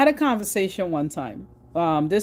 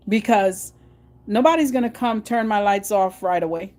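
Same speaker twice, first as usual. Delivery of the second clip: normal talking voice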